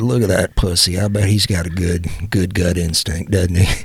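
a hint of a sneering cowboy, slowing on gut instinct. A cowboy in the store mocks the host, lightly voiced sneer. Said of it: "There's a cowboy walked up and said".

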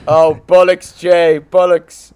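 high, loud hammed-up English accent; mock English accent. Complaining to Jay about these chaps, hammed-up English accent. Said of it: "like English, Australian, Irish".

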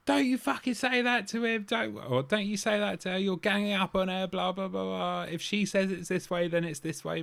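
high-pitched imitation of butting in; mock indignant outburst. Retelling Danny leaping into an argument, mock indignant. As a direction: rattle it off like a meddling mate. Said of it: "Danny just leapt in, being like".

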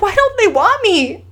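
high, rising pleading voice of her crying husband; a husband's tearful disbelief, voiced high; retold crying plea. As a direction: pitch climbing as if near tears. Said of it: "he called me crying".

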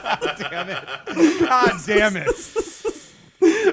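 real laughing, words stretched out high; loud, high, laughed-out exasperation; helpless laughter. Real, not acted. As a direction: curse while cracking up. Host cracking up at failed accent.

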